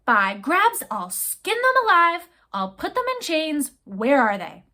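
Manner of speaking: captain raging about the stowaways; furious threats. Acted. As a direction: spit out every threat. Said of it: "he exploded again".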